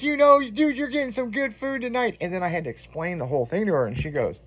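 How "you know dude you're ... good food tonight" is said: high, excited mock hype; excited friend. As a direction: play the buddy hyping up a great meal.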